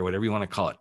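normal talking voice

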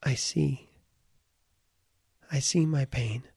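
ordinary talk, nothing marked

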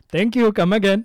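put-on loud, high Indian accent; thick Indian accent, shouted out. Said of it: "Say that in a very Indian accent".

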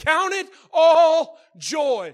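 loud, slow, high proclamation; shouted scripture. Acted. Preacher shouting the verse to the church, slow and loud. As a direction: thunder each word out. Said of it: "No, he said".

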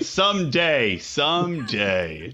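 low, slow, drawn-out longing; mock wistful. Joker desperately waiting for his moment, mock wistful.

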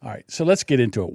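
ordinary talk, nothing marked

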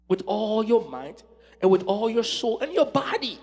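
impassioned preaching. Real, not acted. Preacher telling the congregation body and mind count too, impassioned preaching. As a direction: press each point home to the congregation.

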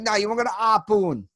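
cartoon-thick Boston accent; imitated Boston accent. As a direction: mimic a Boston cab driver, dropping the H.

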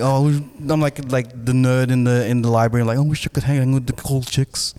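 ordinary talk

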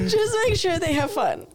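mock her own tragic sigh of a request; mock dramatic plea. Retelling her plea to husband, hammed-up tragic and high. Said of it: "It was so dramatic".

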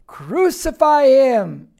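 loud, drawn-out shouted reading; slow, full-volume cry; shouting mob. Acted. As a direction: belt it out like an insistent crowd. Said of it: "they kept shouting the more".

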